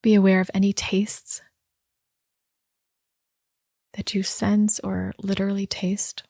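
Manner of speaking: unhurried body-scan guidance with long pauses; guided meditation. Real, not acted. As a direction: guide listeners gently inward, leaving space after each instruction.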